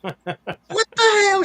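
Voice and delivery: very high, drawn-out disbelief; hammed-up high-pitched bafflement; retold astonishment. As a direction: squeal it slowly in mock shock. Retold reaction to nickname.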